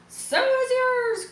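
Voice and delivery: high, slow, excited retort; cried out. A narrator's reading. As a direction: fling it back up high and drawn out. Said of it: "cried Avery".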